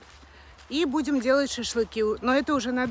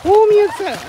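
excited praise; 8 semitones higher, 10 dB louder than usual. Real, not acted. Praising the dog out loud.

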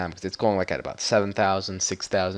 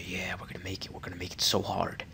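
whisper; 3 semitones lower, 11 dB quieter than usual. Pretend. Whispered hype to himself.

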